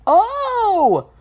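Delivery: high, drawn-out astonished cry. A narrator's reading. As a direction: let out a high oh of amazement. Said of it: "an astonished".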